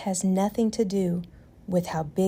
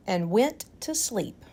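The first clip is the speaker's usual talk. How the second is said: ordinary delivery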